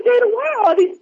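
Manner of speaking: high-pitched puzzled question; mock bewilderment. Asking about all these people on the tour, mock bewildered. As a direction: imitate being baffled by a crowd.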